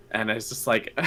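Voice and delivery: ordinary delivery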